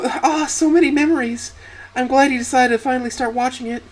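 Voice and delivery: very high-pitched, gleeful squeal of a voice; gleeful wife. Acted. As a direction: squeal it with delight to your daughter. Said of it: "squeeze with glee talking to Cynthia".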